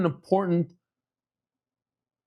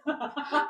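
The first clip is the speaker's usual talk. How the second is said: high, breathy burst of laughter; can't hold back the laugh; 12 semitones higher, 8 dB quieter than usual. Real, not acted. The rabbi laughing at his own lift story, a high burst of laughter.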